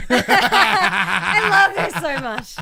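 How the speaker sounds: delighted laugh, slow and loud. Real, not acted. Co-host loving the heel promo, laughing loudly. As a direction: laugh through the words with glee.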